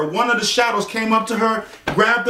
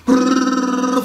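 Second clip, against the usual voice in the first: trilled brrrr for fluttering wings; imitated wing flapping; 4 semitones higher, 3 dB louder than usual. Narrator imitating shadows' wings chasing Kayla, fast trilled flutter. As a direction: roll out a loud, steady flutter. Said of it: "the wings were flapping, like".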